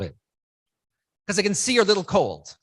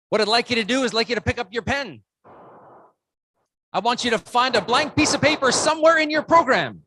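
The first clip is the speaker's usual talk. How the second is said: usual speaking voice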